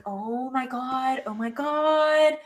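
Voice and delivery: slow, hammed-up fluster; mock panic. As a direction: play the flustered victim of her stare. Intimidated player under her stare.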